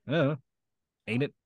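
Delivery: a subtle Oklahoma twang on ain't it; lightly voiced Oklahoma accent. Shown as a demonstration. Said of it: "tried to work on my Oklahoma".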